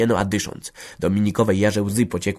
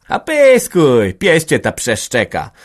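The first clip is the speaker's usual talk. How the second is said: calm dismissive mutter; 6 semitones higher, 8 dB louder, slower than usual. Acted. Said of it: "mruknęła stara dosyć spokojnie".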